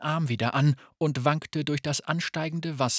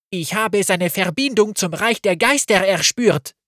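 calling out; 6 semitones higher, 8 dB louder than usual. Acted. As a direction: cry it out as an accusation. Saba accuses Gavin, still shouting.

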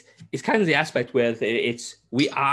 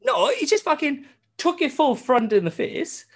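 excited exclaim. Real, not acted. Exclaiming at game scene.

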